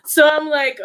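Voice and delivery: ordinary delivery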